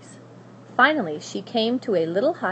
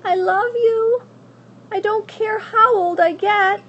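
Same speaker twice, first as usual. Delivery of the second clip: put-on devoted young girl; 13 semitones higher, 7 dB louder than usual. Abilene promising Edward her love, high and tender. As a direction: promise it with all your heart.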